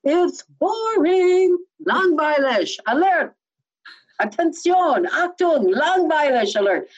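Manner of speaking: high, chanted warning announcement; mock boredom alert. Trainer warning that credible language gets boring, mock alert. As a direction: call it out high like a playful alarm.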